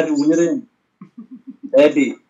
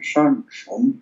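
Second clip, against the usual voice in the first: a hint of Connery's Scottish burr on Sean; lightly voiced Connery, with a soft sh; Sean Connery impression; 4 dB quieter than usual. Trying Connery's voice for a friend, lightly. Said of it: "you got Sean Connery".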